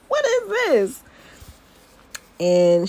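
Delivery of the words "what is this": mock-shocked wait, what is this; surprised. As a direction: stretch it out in stunned disbelief. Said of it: "but then I'm like".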